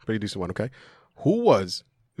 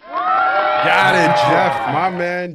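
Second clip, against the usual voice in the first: excited cheer; 7 semitones higher, 7 dB louder, much slower than usual. Real, not acted. Host cheers correct answer.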